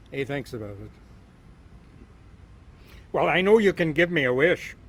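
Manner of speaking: loud, animated Irish-tinged story telling; lively storytelling. Acted. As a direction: spin the tale big for the crowd. Storyteller tells leprechaun tale.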